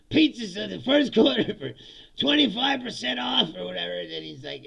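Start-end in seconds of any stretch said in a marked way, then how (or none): 0.1-3.5 loud, raised parody sales pitch; hyped company president. Acted. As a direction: pitch it to a packed hall.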